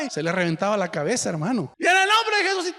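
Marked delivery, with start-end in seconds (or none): 1.9-2.7 high, shouting rebuke; over-the-top exorcism cry; mock shouted prayer. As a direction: yell it into her ear.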